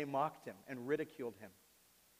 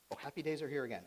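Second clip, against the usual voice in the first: ordinary talk, nothing marked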